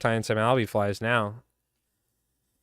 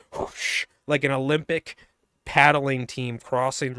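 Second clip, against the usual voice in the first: ordinary talk, nothing marked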